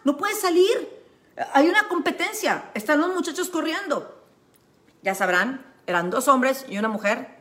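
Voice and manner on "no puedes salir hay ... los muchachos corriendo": high, urgent call to the driver; retelling how she called out to a drunk driver; calling out to stop the car. Retold or imitated. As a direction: shout him down, wave the car to stop. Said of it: "Y dije".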